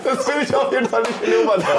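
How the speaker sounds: laughing. Real, not acted. Laughing at his own joke.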